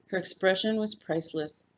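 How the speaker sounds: plain reading voice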